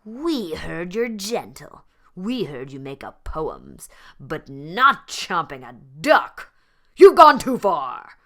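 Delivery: loud, rising scolding pack; angry lions. A narrator's reading. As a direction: build to a roaring telling-off. Fierce lions confront Leonard.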